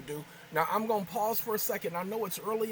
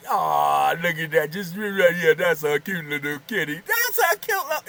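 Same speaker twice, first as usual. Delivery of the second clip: cooing at the kitty; 10 dB louder than usual. Real, not acted. Cooing over the kitten.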